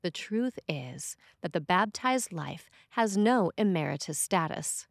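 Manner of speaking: usual speaking voice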